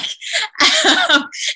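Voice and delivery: squealing giggle; short high giggle; high squeal laugh. Real, not acted. Laughing at the memory.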